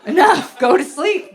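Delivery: loud, fed-up mock outburst; exasperated yelling. Wife woken at night by husband, exasperated. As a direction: yell at him to let you sleep.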